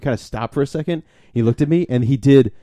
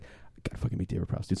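whispered aside; 4 semitones lower, 16 dB quieter than usual. Retold or imitated. Retold excited aside.

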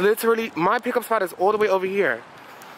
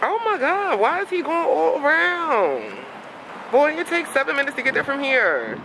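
exasperated complaint; 9 semitones higher than usual. Real, not acted. Rider watching the Uber take the long way round, exasperated. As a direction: wail it in disbelief at the driver.

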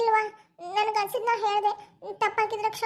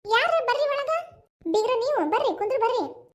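calling out; 4 semitones higher, 3 dB louder than usual. Acted. Calling out to a visitor.

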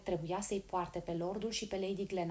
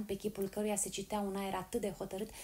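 plain reading voice

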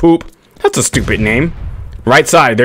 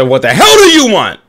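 retold shout; 7 semitones higher, 4 dB louder than usual. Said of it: "I said".

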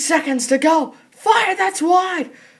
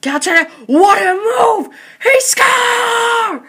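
excited goal call; 4 semitones higher, 5 dB louder than usual. Acted. Tying goal called.

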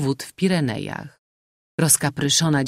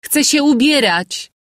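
much higher, louder command; shouting; 12 semitones higher, 7 dB louder than usual. A narrator's reading. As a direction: shout after her mother for the maid. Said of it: "krzyknęła Mojna".

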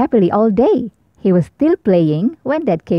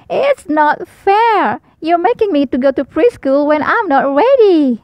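put-on Baby Owl protesting preschool, higher and whiny; 7 semitones higher, 3 dB louder than usual. A narrator's reading. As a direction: whine it like a toddler. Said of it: "cried Baby Owl".

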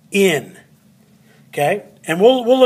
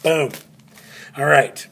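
ordinary talk, nothing marked

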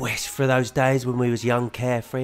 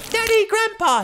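put-on high child's cry, much louder; 6 dB louder than usual. A narrator's reading. Boy calling his daddy in alarm, high loud cry. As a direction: cry out like a frantic little boy. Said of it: "he cries with a gasping gush".

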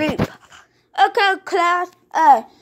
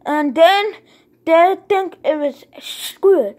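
normal talking voice

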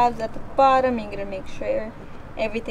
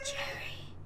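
whisper-shout; 12 dB quieter than usual. Real, not acted. Hushing the rooster in whisper.

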